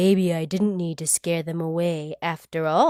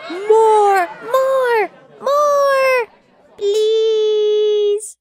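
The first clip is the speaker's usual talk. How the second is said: high, loud pleading cries of more; squealing plea for more; begging for more; 14 semitones higher, 7 dB louder, much slower than usual. Acted. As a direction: beg eagerly like an excited kid. Child begging for more stories.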